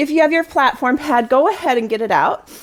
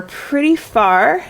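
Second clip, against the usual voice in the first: drawn out; 4 semitones higher, much slower than usual. Real, not acted. Stretching words to show distance.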